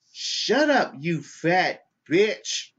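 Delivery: high, drawn-out mock snarl; hammed-up rude lady; snapping insult. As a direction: fling the insult with a high, slow sneer. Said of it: "The lady with the dog goes".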